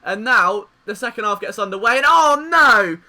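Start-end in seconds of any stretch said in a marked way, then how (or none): 2.0-3.0 loud, drawn-out groan of dismay; dismayed cry. Real, not acted. Fan sees the second half go wrong, loud and slow. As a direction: cry out as the goal goes in. Opponent scores at kickoff.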